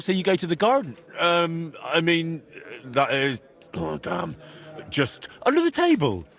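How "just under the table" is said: incredulous. Acted. Incredulous repeat, pitched up.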